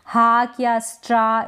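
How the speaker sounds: हाक and स्ट्रा with open "aa"; wrong aa vowels. Shown as a demonstration. Teacher showing wrong readings, हाक and स्ट्रा with "aa". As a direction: mispronounce them as हाक, स्ट्रा. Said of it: "इस तरीके से ना पढ़ें".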